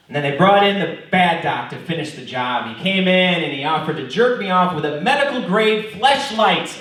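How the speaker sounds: normal talking voice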